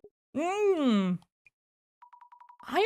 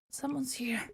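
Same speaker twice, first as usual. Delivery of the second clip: whisper; 11 dB quieter than usual. Acted. Scared on noticing someone.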